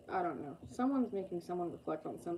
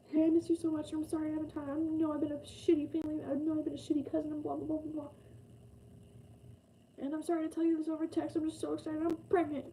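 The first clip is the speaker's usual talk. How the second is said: much higher, gushing cousin voice; excited cousin; 9 semitones higher than usual. Retold or imitated. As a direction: gush it up high like an overexcited relative. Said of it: "They might be".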